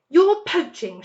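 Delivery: shrill outraged squeal; shrieked. A narrator's reading. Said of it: "shrieked Rat".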